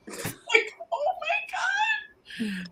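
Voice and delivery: high, drawn-out laughing squeal; amused disbelief. Real, not acted. Host reacts to a guy's funny answer, laughing in disbelief. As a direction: squeal it slowly through laughter.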